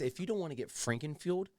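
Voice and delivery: ordinary talk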